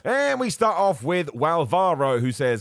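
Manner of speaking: loud, high, drawn-out introduction; announcer-style kickoff. Acted. Host opening listener questions, announcer-style kickoff. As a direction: launch the segment like a slow, booming announcement.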